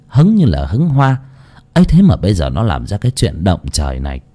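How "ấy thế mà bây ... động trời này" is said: plain reading voice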